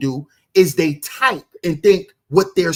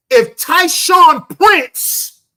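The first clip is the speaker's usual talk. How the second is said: high, loud and very drawn out; stretched, emphatic yell; shouted name; 9 semitones higher, 8 dB louder, much slower than usual. Real, not acted. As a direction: holler the name slowly for effect. Loudly offers a contrasting name.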